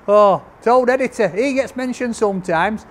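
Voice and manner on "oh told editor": usual speaking voice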